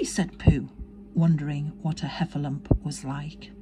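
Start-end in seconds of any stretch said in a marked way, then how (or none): none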